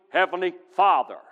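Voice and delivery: ordinary talk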